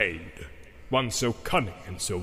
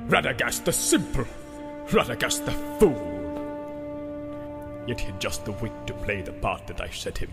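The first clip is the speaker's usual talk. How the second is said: open scorn; 4 dB quieter than usual. A narrator's reading. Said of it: "concealed his scorn".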